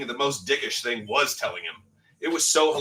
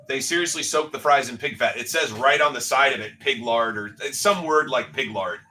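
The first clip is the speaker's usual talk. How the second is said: normal talking voice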